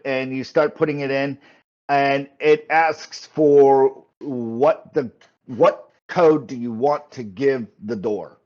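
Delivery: ordinary delivery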